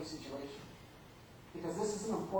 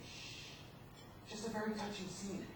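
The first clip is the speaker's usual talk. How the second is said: quiet shush; 3 semitones higher, 10 dB quieter, slower than usual. Retold or imitated. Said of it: "I just turned quietly".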